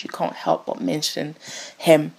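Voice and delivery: ordinary talk, nothing marked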